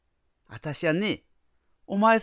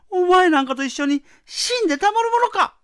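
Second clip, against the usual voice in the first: higher, louder furious shout; 8 semitones higher, 7 dB louder than usual. Acted. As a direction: scream it in defiance. Wife refuses, climax.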